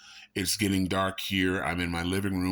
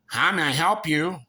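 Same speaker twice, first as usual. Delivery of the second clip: high, loud singsong service greeting; overdone eager counter-worker voice; customer service greeter; 9 semitones higher, 6 dB louder than usual. Acted. Worker offering a customer help, hammed-up service voice.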